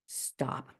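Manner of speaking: breathy whispered command to herself; whispered stop. Retold or imitated. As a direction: hush yourself firmly under your breath. Calming herself in anger.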